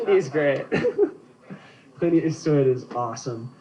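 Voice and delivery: normal talking voice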